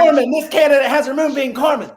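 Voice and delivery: high, loud mock recruit voice; hammed-up high female candidate voice; mocking the girl. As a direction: ham up her report, squeaky and eager. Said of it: "Fucking moonbeam".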